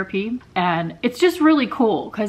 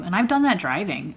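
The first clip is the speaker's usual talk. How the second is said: ordinary delivery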